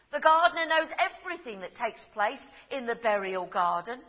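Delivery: ordinary talk, nothing marked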